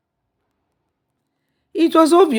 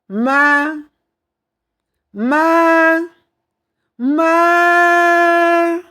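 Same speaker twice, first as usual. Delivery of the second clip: calls climbing higher and louder each time; answering call; 11 semitones higher, 4 dB louder, much slower than usual. A narrator's reading. Son answering his mother's distant call, rising cries. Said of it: "He answered".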